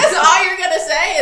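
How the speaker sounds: half-laughing, incredulous at his silence; laughing disbelief. Retold or imitated. Wife exasperated at silent husband, laughing.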